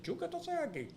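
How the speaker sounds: imitating a doctor's high, surprised greeting; a sudden high jump of surprise on seeing a friend; doctor startled to find him at the hospital, surprised and high.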